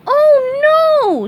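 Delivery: very high, loud alarmed yelp; shocked cry. Acted. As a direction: gasp it out in horror. Camera explodes, SpongeBob.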